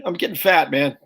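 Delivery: normal talking voice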